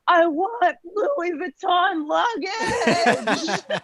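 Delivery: high, very drawn-out comic wail; mock yell skyward. Frustrated traveler dropping heavy bags in the airport, hammed-up yell. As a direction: imitate a frustrated cry to the heavens, stretching every word. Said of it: "to the sky yelled".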